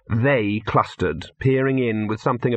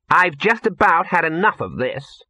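put-on higher-pitched, exasperated girl; fed up; 6 semitones higher, 3 dB louder than usual. Actress complains beside a stranger, fed up. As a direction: complain as someone at the end of their patience.

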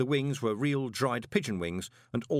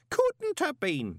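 high, slow insistent protest; 3 dB louder, much slower than usual. A narrator's reading. Groat protesting to the golem, high and slow. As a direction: dig in and refuse it. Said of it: "Groat protested".

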